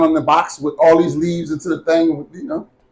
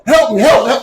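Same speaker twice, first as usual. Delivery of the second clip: retold cry for help, higher pitched; mock yell for help; 6 semitones higher, 3 dB louder than usual. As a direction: yell help as if through laughter, retelling it. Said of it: "I yelled".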